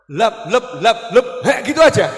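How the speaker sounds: loud, high slurp-and-gulp imitation; mock slurping noises. Husband wolfing down his meal, mock loud slurping. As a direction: play the husband gobbling food loudly. Mimics husband eating without thanks.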